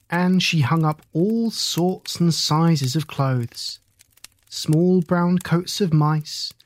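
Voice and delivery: ordinary delivery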